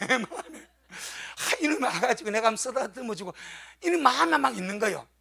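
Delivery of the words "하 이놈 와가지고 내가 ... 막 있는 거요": usual speaking voice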